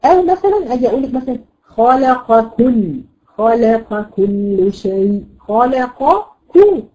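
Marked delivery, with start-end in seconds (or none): 3.3-6.8 خلق كل drawn out slowly, qaf and kaf kept apart; slow recitation. Shown as a demonstration. Teacher recites a verse to show neighbouring letters. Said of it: "تجاورت القاف معك".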